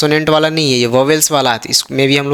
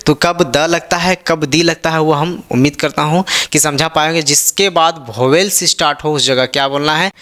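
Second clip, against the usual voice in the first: ordinary delivery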